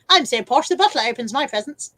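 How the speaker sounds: loud, put-on received pronunciation; mock-posh English accent. Streamer reading a viewer's boast, mock posh. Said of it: "I'm going to do that voice".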